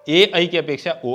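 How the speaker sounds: ordinary talk, nothing marked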